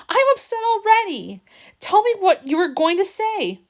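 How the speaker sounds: high, insistent and upset; upset demand. Acted. Jill to Harrison, demanding he speak, upset. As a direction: press him to tell the truth.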